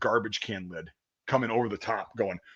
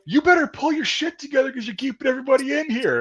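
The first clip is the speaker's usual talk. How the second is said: high, sharp drill sergeant yell; shouting sergeant; 12 semitones higher than usual. Retold or imitated. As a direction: bark it like a drill instructor. Drill sergeants yelling at recruit.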